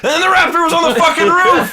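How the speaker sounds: high, loud, disbelieving yell; incredulous shout. Real, not acted. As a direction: shout it like you can't believe it happened. Disbelief that the prediction came true.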